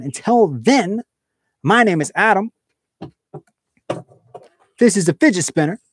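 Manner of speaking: high, loud announcer outro; announcer sign-off. Acted. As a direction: announce the channel name with flair.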